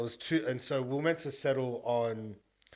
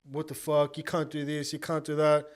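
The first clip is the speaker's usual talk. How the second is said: raised, heated voice, louder at the end; mock the agent blowing up at you; a retold agent's angry protest; angry outburst; 4 semitones higher, 4 dB louder than usual. Angry agent confronting him.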